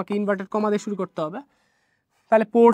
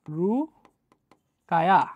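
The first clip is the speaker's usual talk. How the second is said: Rukaiya split into two long, slow syllables; drawn-out dictation; 3 semitones lower, 3 dB quieter, much slower than usual. Shown as a demonstration. Teacher writing out the name, drawn-out dictation of Rukaiya. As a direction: stretch Rukaiya out with a long gap after রু.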